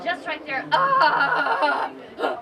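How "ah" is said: loud strained ah with a laugh; ugly strained ah. Shown as a demonstration. Teacher shows her ugly spot, a loud strained ah. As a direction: push loudly into the ugly spot. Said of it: "that ugly spot".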